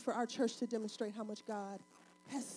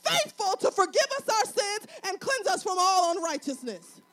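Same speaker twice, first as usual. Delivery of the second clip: high, loud, punched-out exhortation; full-force preaching; 8 semitones higher, 8 dB louder than usual. Real, not acted. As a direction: proclaim it to the whole church.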